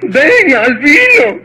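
mother pleading. Acted. Mother begs Albino to come home, pleading. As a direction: beg him to come home. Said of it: "la madre suplicó".

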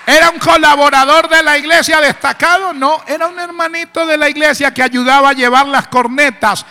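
loud, high preacher's call; preaching at full force. Acted. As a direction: rouse the congregation to give glory. Preacher shouting praise.

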